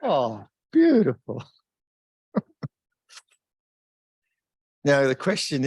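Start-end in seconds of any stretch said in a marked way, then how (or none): 0.0-1.4 hammed-up wonder, swooping higher; playfully awestruck, with big pitch swoops; acted admiration. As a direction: marvel aloud, stretching out the praise. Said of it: "and I thought".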